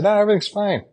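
customer. Retold or imitated. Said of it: "they say".

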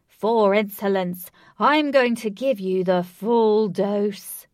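slow, loud menacing hissed snap; snapping. Acted. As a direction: spit it out with venom. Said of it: "he snapped".

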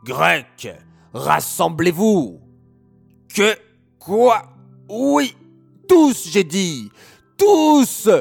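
wicked king roaring with rage; furious yelling king. Acted. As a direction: bellow orders at his army in fury.

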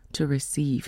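ordinary talk

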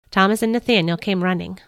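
ordinary delivery

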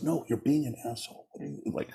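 hushed mock accusation. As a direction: mutter a retold accusation, fading away. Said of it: "And he goes".

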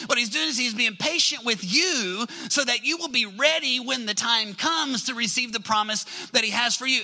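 ordinary talk

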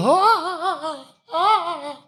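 very high whimpering sobs; mock sobbing. Elf girl sobbing at angry dad, squeaky mock sobs. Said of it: "this girl's like".

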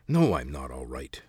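put-on a testy old man's retort; snapped. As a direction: bite the answer off sharply. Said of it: "the voice snapped back".

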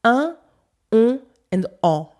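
"Un", "on", "en" as three separate French nasals; nasal vowels. Shown as a demonstration. Teacher demonstrates three Parisian nasals, "Un", "on", "en" isolated. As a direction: sound out "Un", "on", "en" one by one through the nose. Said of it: "I only make three nasal sounds".

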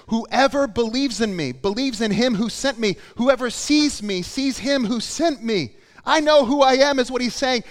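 loud, high, emphatic proclamation; Jesus crying out. Retold or imitated. Preacher voicing Jesus' final appeal, shouting it out. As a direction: shout each claim out with building force. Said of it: "He shouted".

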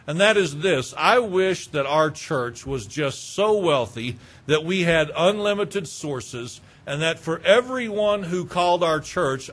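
ordinary talk

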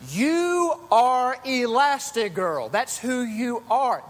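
preacher retelling Edna's pep talk, stern; Edna Mode. Retold or imitated. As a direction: state it firmly like the fierce designer. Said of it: "And Edna said".

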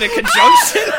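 high, squeaky laughing question; cracking up mid-question. Real, not acted. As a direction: laugh through the word.

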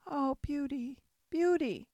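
high, pleading moan of a dying beast; mournful Beast calling Beauty; sad Beast. A narrator's reading. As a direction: call her name in weak sorrow. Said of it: "looked at her sadly".

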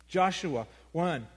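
ordinary talk, nothing marked

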